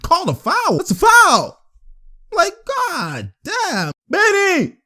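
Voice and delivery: high, drawn-out complaint at the refs; real fan outrage; exasperated protest. Real, not acted. Yells at refs over no-call.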